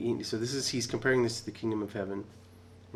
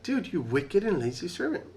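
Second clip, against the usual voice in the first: high, loud scolding rant; the master scolding the servant who buried the silver, high and loud; 9 semitones higher, 4 dB louder than usual. Retold or imitated. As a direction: scold him hard, pitch way up. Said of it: "scolding him".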